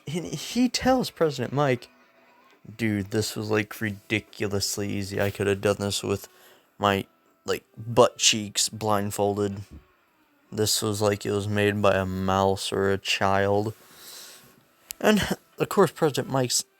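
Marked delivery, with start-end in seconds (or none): 2.8-13.7 low, level monotone; unimpressed low drone; deadpan boast. Retold or imitated. Quoting Zuko's cocky remark to President Mike.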